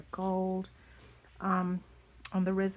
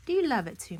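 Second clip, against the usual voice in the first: higher, louder sweet question to the dog; pet talk; 7 semitones higher, 6 dB louder, much faster than usual. Real, not acted. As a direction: coax the pet lovingly. Asking the pet.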